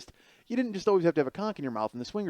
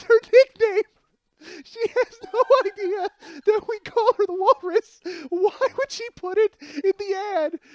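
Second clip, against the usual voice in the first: very high, strained squeal, struggling to talk; crying with laughter, loud and drawn out; helpless laughing; 6 dB louder than usual. Retold or imitated. Telling friends why the nickname is so funny, helplessly laughing. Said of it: "I'm trying to talk".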